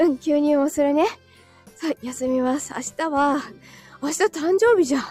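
ordinary talk

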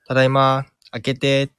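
a lightly voiced mother calling to be let in; mother's voice outside. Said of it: "このお母さんの声がするんですよ".

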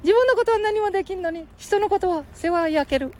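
put-on high squeaky boy voice; Ken-chan voice.

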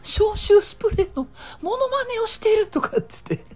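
imitating the wife cracking up; wife laughing. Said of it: "妻がお腹抱えて笑って". (お腹を抱えて笑いながら解説する妻)

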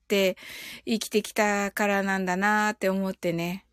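usual speaking voice

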